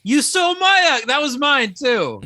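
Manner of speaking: high, loud indignant protest. Real, not acted. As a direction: yell that the joke was yours. Friend stole his joke.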